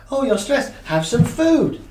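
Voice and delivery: a cheeky little monkey offering a treat; coaxing monkey voice. Retold or imitated. Therapist voicing the brain's monkey to the client, high and coaxing. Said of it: "So the monkey says".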